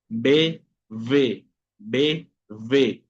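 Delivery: B with both lips, V with lip and teeth; B versus V contrast. Shown as a demonstration. Teacher contrasts B and V for Spanish speakers. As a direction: alternate B and V crisply. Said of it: "Una cosa es B, otra cosa es V".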